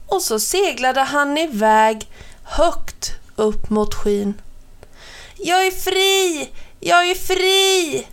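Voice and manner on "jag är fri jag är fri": a towel set free, shouting high; shouting joyfully. A narrator's reading. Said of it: "ropade han så högt han kunde".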